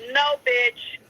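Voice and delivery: usual speaking voice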